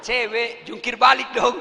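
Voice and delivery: boisterous whistle-call voice; rowdy catcall. Retold or imitated. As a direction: holler it at a passing girl with a laugh. Young men's catcall.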